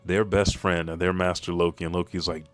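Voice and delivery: ordinary talk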